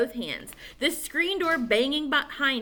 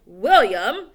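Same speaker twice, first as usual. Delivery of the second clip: loud stern call; 9 dB louder than usual. Acted. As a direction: call the boy's name sternly. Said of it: "he'd cry".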